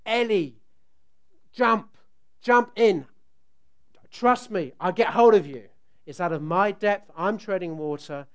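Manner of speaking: loud urgent calls from the water; retold shout. Dad treading water, shouting loud to daughter to jump. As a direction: urge her to jump, loud. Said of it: "I go shout".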